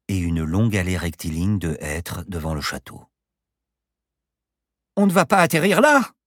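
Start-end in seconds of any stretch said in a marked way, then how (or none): 5.0-6.1 high, loud scream of protest; shrieked, disbelieving objection; panicked yell. A narrator's reading. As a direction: yell in horror at the pilot. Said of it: "hurla Paloma".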